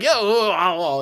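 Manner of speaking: high crowd-style "oh"; mock shocked reaction. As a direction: react like a stunned audience, hammed-up. Imitating crowd reaction to a shocking line.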